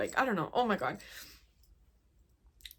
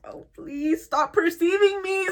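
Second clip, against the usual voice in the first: higher, slow, strained plea; pleading mock whine; 7 semitones higher, 6 dB louder, slower than usual. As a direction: beg them to look away. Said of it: "just be like".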